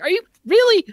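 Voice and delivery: loud, high disbelief; exasperated. Retold or imitated. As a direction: throw it up in disbelief. Mocking the game's boss fight.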